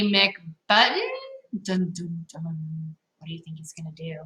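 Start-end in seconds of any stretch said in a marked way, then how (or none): 1.6-4.2 hushed suspense. A narrator's reading. Suspense before the pounce.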